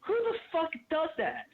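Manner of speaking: real outrage, pitched high; livid. Real, not acted. Caller ranting about a friend stabbed in the back, livid. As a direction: demand it in high-pitched, indignant disbelief. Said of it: "fucking livid right now".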